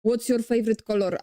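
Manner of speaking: quick Polish-accented delivery with hard Rs. Shown as a demonstration. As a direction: roll the Rs in a thick Polish accent. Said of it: "I'm not speaking like that".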